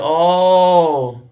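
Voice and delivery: big drawn-out oh of understanding; sudden realization. Real, not acted. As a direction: let the penny drop noisily.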